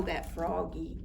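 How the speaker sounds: plain reading voice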